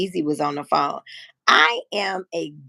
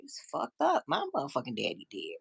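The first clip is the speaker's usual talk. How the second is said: breaking grief; 6 semitones lower, 11 dB quieter than usual. Real, not acted.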